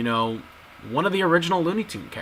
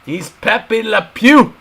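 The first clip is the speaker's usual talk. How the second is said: mock French accent, pitched up; a corny French skunk accent; bad French accent; 8 semitones higher, 8 dB louder, slower than usual. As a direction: ham up a cartoon French accent. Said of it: "With that French accent".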